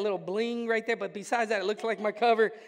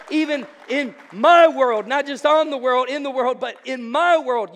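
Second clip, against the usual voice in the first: fervent loud prayer; 2 semitones higher, 10 dB louder than usual. Acted. Fervent prayer, preacher at full force.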